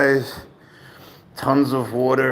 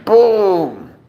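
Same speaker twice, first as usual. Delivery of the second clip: excited exclamation; 8 semitones higher, 8 dB louder than usual. Real, not acted. Celebrating the cat again.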